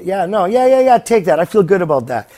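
loud, eager yes-yes-yes; eager agreement. Retold or imitated. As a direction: pile on the yeahs like a hooked buyer. Other side happily accepts the deal.

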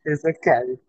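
exasperated whisper. Retold or imitated. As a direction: breathe it out in disbelief. Retold exasperated reply to customers.

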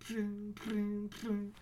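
ringing metallic "cling"; imitated phone ring. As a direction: mimic an old telephone bell. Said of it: "you hear that ring".

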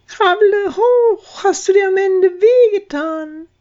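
high, squeaky and tearful; tiny worried mouse calling down; baby chick. Acted. Braut ruft in Grube. (besorgtes, weinerliches Piepsen)

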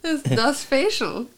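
hammed-up German accent, drawn out slowly; best German accent. Player bluffs as Carl on the radio, slow German accent. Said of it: "I'll do my best German".

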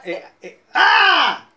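high, loud single shout; demo cry out. Shown as a demonstration. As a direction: show a real outcry, full voice. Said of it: "Clamar significa".

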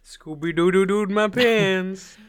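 loud, high, drawn-out fake wail; mock child confessing. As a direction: slowly wail it like an embarrassed kid. Repeats the childish accident confession.

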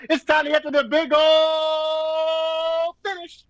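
high, long-held announcer-style build; drawn-out hype. Pretend. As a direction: stretch "ol'" out and hold it high. Hypes WrestleMania with a long held word.

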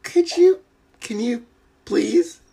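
very high-pitched, wheedling plea; eager pleading. Real, not acted. Host begs guest to do her telephone voice, eager pleading. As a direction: beg in a squeaky high little voice.

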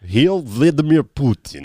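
hammed-up Russian accent; mock Russian accent. Mocking the show's Russians with a fake Russian accent.

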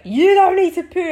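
mock midwife, high and loud, flatly denying it; imitating a midwife calling out a firm no. Midwife telling the mother in labor she doesn't, high and loud. Midwife contradicting her, retold.

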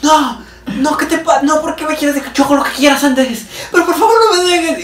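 imitating a schoolgirl begging her boyfriend to stay; begging teen girl. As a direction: beg Andrés not to leave in a high whine.

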